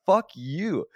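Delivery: high, loud retold cursing; angry outburst. Man telling off his coworker, loud anger. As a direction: let it burst out high and loud. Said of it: "I got mad about that shit".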